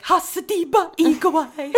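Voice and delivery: high, loud gibberish imitation of Siri; mangled Siri pronunciation. Mocking how Siri says things, loud mangled gibberish. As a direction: garble it like a botched navigation voice. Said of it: "the way she pronounces things is fucked up".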